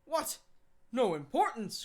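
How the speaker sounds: the judge can't believe his ears; indignant cry. Acted. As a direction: judge cries out at Lupin's dismissal, indignant. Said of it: "cried the judge".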